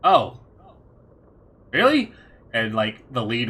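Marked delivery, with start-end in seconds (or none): 0.0-2.1 loud, high-pitched surprise; taken aback villain. Acted. Villain learns his targets are already killed, taken aback. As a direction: jump up high in disbelief.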